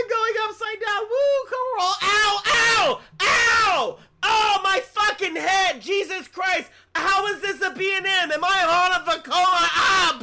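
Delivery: screaming on a rough coaster; on a roller coaster. Pretend. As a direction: yell high and loud like being thrown around. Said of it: "I'm gonna do it in sound effects".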